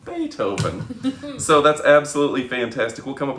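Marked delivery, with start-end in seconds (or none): 0.1-1.4 excited. Real, not acted. Announcing correct answer.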